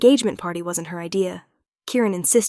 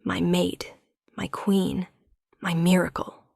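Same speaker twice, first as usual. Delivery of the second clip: hushed, adoring whisper; 4 dB quieter, slower than usual. A narrator's reading. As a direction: murmur each word softly. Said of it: "he whispers back".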